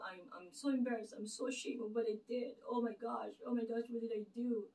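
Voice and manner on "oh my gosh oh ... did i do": ordinary talk, nothing marked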